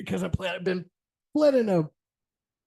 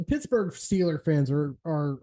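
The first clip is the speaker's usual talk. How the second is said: normal talking voice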